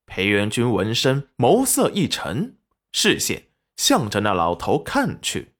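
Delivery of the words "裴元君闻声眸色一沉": usual speaking voice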